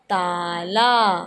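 ताला said slowly as one word; slow blended word. Shown as a demonstration. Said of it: "तो क्या हो गया?".

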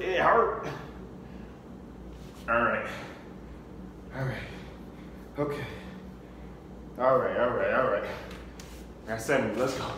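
ordinary talk, nothing marked

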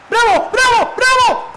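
high, loud victory scream; real gamer celebration yell; ecstatic shout. Real, not acted. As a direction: roar it like a last-minute winner. Celebrating goal by Bravo.

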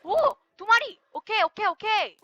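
high, bursting exclamations; delighted excitement. Real, not acted. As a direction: cheer at a lucky find. Spots two animals, delighted. (신나서 높게 외치는 감탄)

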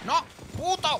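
sharp, high, loud curse; angry outburst. Real, not acted. Gamer cursing an enemy, angry high outburst. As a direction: spit the insult out at the game.

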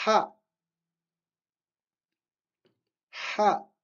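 Ha with air pushed from the throat; breathy throat h. Shown as a demonstration. Teacher models Ha, air from the throat. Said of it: "On fait sortir de l'air de la gorge".

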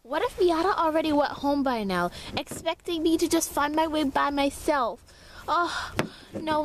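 ordinary talk, nothing marked

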